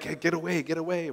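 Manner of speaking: soft, muttered get away, get away; mock brush-off. Preacher quietly replaying the stranger's rebuff, soft. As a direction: wave him off under your breath.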